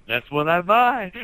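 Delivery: loud, high, drawn-out imitation of delight; mock excited exclamation. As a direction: imitate your friend crowing it slowly and loudly. Retelling friend's delighted reply about vodka.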